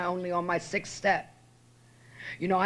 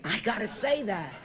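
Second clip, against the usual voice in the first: laughing; 3 semitones higher than usual. Real, not acted. Laughing at own joke.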